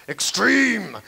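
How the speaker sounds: mock-epic shout; mock hype. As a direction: belt it out like an extreme-sports hype man. Mock hype for card tricks.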